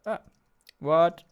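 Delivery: normal talking voice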